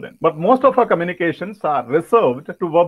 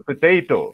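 "Potato" stressed on TAY; second-syllable stress; 2 semitones lower than usual. Shown as a demonstration. Trainer shows where stress falls, second-syllable stress. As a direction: lean on TAY in "Potato", puh-TAY-to. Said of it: "stress is on the second syllable".

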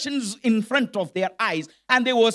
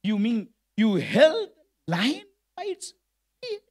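acted incredulity. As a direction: question it haltingly, voice climbing in doubt. King reacts in disbelief, then laughs.

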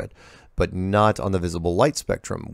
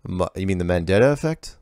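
usual speaking voice

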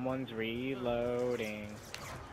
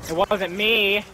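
louder, higher protest; defensive shout; 6 semitones higher, 9 dB louder, slower than usual. Real, not acted. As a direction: yell to deny the blame.

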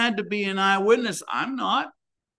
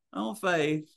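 soft, dismissive "Oh, faith." with a falling shrug; 5 dB quieter than usual. Retold or imitated. Mocking someone who belittles faith, dismissive and soft. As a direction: brush faith aside like it hardly matters. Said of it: "Don't ever go".

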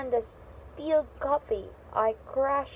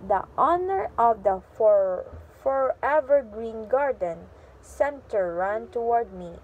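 ordinary delivery, nothing marked

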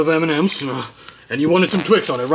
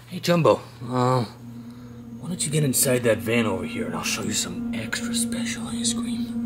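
soft, creepy whispered lure; sneaky whisper; 9 dB quieter than usual. Acted. Orange Pop lures Jumbo into the van, whispering slyly. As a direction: whisper it like a stranger with a trap.

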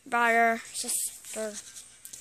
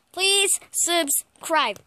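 pleading; 4 semitones higher, 6 dB louder, much slower than usual. Real, not acted. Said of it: "asking viewers to subscribe".

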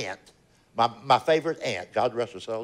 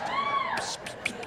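spray hiss; 7 dB quieter, slower than usual. Retold or imitated. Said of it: "It's a butter mist".

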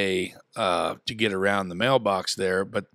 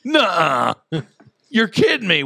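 mock disbelief; 8 semitones higher, 4 dB louder than usual. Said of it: "And I was like".

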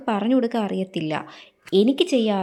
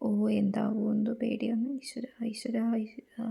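nervous whisper; 9 dB quieter than usual. Acted. Fearful whisper before results.

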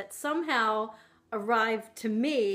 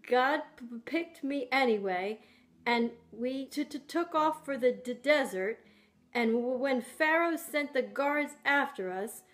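stuttering. Acted. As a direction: stutter on first sounds. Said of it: "I used to stutter".

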